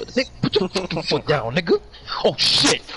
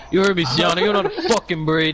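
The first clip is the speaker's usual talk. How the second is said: raised, higher teasing jab at a friend; mock taunt; 5 semitones higher than usual. Teasing Sienna because she can't braid hair.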